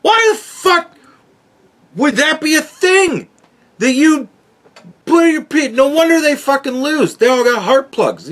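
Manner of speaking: incredulous comedic rant about a silly movie detail; exasperated rant. Real, not acted. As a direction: pitch it way up in outrage. Ranting about Dune heart plugs.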